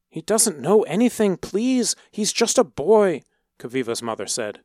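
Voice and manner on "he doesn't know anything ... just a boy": desperate begging. A narrator's reading. Mother begs for her son.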